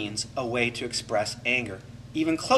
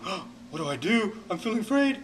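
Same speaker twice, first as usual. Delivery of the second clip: fretful, pitch climbing on afraid; anxious inner voice; 5 semitones higher than usual. Shown as a demonstration. Showing clients the fear cycle, anxious fretting. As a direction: spiral into worry as you say it. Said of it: "trapped into that fear cycle of going".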